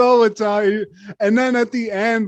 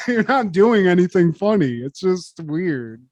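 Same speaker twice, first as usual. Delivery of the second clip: ordinary talk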